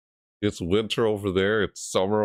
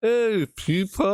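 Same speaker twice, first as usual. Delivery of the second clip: put-on greeting; 3 dB louder, slower than usual.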